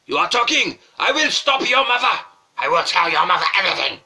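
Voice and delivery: shouting teacher in a bad Japanese accent; mock Japanese-accented teacher yelling at a pupil; terrible Japanese accent. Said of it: "Forgive my extremely terrible accent".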